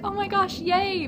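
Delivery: high giddy gush; fangirl squeal. Retold or imitated. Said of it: "I was like".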